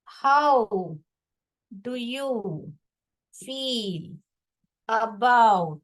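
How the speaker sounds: one word at a time, long pauses; spaced-out word-by-word classroom dictation; dictating. Real, not acted. Teacher dictating last question for writing.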